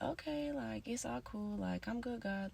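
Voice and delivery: lightly mocking casual voice; mock carefree. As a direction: mimic a careless stoner brushing it off. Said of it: "And then just be like".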